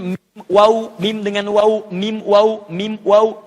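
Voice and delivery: "mim, waw" with lips pressed, then rounded; lip letters mim and waw. Shown as a demonstration. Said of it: "ada yang di bibir".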